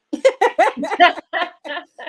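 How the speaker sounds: loud high laugh; delighted laugh. Real, not acted. Flattered by comment.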